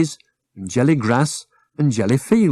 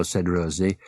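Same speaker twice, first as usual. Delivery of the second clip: ordinary delivery, nothing marked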